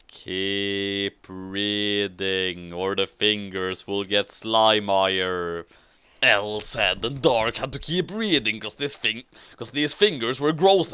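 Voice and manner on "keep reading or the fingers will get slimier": slow, low menacing whisper of a villain; a creepy villain threatening, low and slow. Acted. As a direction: drag it out like a creepy stalker. L's creepy line read aloud.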